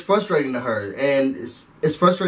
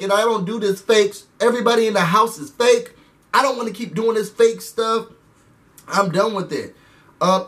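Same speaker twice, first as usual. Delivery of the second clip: mock upset rant; 5 semitones higher than usual. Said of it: "And she's like".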